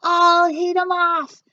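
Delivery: high, loud, slow shout; cried out. A narrator's reading. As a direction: gym teacher joining the chase. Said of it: "cried the gym teacher".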